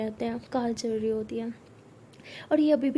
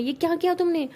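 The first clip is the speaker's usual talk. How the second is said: higher, louder accusing question; mother confronting her daughter about the cooking, angry; mother scolding; 6 semitones higher, 5 dB louder than usual. Acted. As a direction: demand an answer sharply. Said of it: "उसकी मम्मा कहती".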